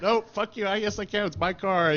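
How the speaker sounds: mock-defiant retort, high and a bit loud. Ed brushing off the Ferrari mechanics, a hammed-up defiant retort. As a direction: toss it off like a cocky brush-off.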